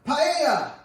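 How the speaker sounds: high, loud cry of a single word; hammed-up, drawn-out exclamation; exuberant shout. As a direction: holler it like a big reveal. Sudden shouted gag word.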